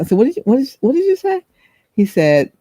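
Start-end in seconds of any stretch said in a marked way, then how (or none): 0.2-1.4 puzzled asking. Retold or imitated. Asking again.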